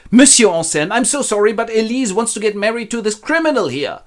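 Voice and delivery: high-pitched indignant explanation; aggrieved apology. Acted. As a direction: apologize while fuming at the culprit. Miser complains to promised suitor.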